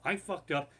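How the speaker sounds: ordinary talk, nothing marked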